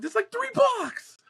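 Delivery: very high, slow, outraged shout; exasperated shout. Retold or imitated. Son furious that mom skipped the nearby office, exasperated shout. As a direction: yell it in disbelief.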